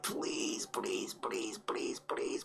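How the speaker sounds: rapid-fire hushed pleas; whispered begging. Real, not acted. Streamer begging a download to start, whispered pleading. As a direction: beg in a tiny whisper.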